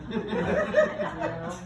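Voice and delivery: ordinary delivery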